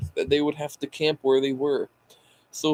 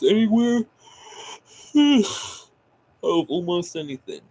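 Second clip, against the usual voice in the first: yawning through words; 6 semitones higher, 3 dB louder, slower than usual. Real, not acted. Tired reader yawns mid-sentence.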